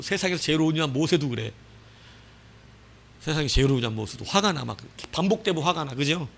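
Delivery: usual speaking voice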